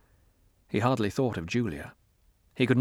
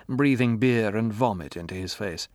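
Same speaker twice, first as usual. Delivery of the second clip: usual speaking voice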